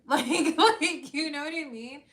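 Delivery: loud burst of laughter over the words; laughing. Real, not acted. Laughing at her own blunt joke about the tumor, loud laugh. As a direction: crack up mid-sentence.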